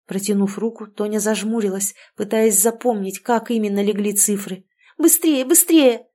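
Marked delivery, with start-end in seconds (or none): 5.0-6.1 high, loud, drawn-out urging; urgent cry. A narrator's reading. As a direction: hurry them along at full voice. Urging others to hurry.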